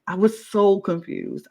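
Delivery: ordinary delivery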